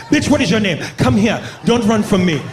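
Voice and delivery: put-on loud, high African accent, commanding; mock African accent. Acted African man stalking women.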